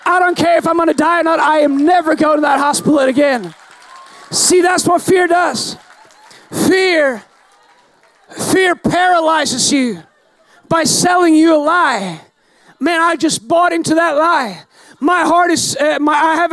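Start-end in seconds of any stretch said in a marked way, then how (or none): 4.3-12.2 slow, emphatic preacher's point; preaching. Acted. Preacher hammering the lesson to the church. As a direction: drive the point home to the congregation.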